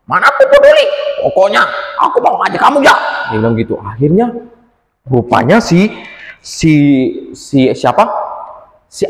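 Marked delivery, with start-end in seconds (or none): 0.1-2.9 cheeky defiant little creature. Acted. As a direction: snap back that you don't care. Anjani defiant to porcupine.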